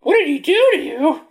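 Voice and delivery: very high, loud incredulous voice; squeaky high voice. Acted. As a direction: squeak out the question. Player line voiced very high.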